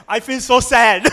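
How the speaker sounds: real laughter breaking into the line; laughing through the words. Real, not acted. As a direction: laugh as you say it. Laughing mid-story.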